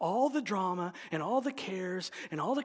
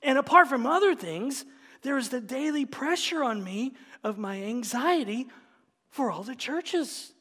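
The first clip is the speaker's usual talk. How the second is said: raised, ringing scripture reading; preaching reading; 5 semitones higher, 4 dB louder than usual. Acted. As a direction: proclaim the hardships from the pulpit. Reads the apostle's hardships aloud.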